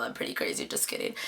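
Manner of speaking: breathy joking whisper, almost voiceless; whispered aside. Real, not acted. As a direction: drop to a secret whisper and tease.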